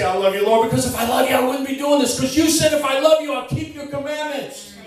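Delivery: loud, high preacher's climb, driving each question home; preaching at full force. Acted. Preacher rousing the congregation about lying to God, at full force. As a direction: shout it from the pulpit like a revival preacher.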